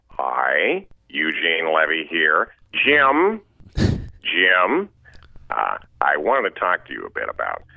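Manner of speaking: halting dad giving an embarrassing talk; Eugene Levy impression. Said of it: "you do another character from that film".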